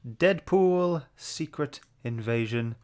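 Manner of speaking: normal talking voice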